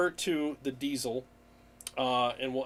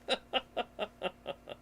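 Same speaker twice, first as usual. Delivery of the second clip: breathy laugh; 8 semitones higher, 9 dB quieter than usual. Real, not acted. Laughing at a chat joke.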